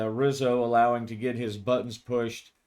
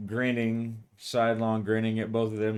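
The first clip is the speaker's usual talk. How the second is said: usual speaking voice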